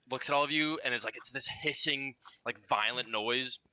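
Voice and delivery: ordinary delivery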